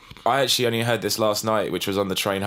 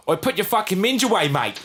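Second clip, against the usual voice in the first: put-on poor man's Australian accent, pitched high; comedy impression of an overheard Australian; 9 semitones higher than usual. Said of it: "in an Australian accent".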